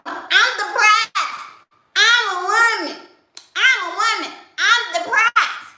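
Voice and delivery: high mock self-empowered woman; mocking viewers. Mocking viewers who repeat slogans, high slow voice. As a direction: parrot the internet line high and slow. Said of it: "Y'all want".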